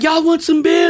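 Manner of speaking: high-pitched imitated holler. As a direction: call out like a buddy offering drinks. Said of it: "who he's hollering at".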